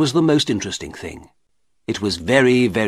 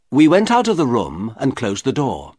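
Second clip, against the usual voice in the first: usual speaking voice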